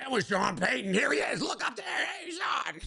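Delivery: high, shouty party host; hype host voice. Pretend. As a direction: call out like a hyped host filming. Mocking owner filming coaches.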